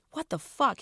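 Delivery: demanding. Acted. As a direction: demand an answer, hurt and outraged. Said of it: "he demanded".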